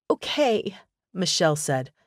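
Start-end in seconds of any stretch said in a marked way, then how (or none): 0.1-0.8 high okay, voice cracking with tears; voice breaking. A narrator's reading. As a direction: let the voice crack. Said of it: "her voice breaking".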